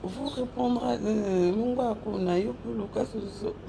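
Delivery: ordinary talk, nothing marked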